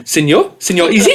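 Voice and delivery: put-on a light Spanish-accented "Senor Easy?", rising; mock Spanish accent. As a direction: greet him like a Spaniard, half-laughing. Imagined Spaniard greeting him.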